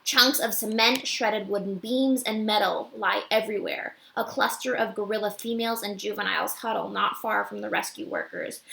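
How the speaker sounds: usual speaking voice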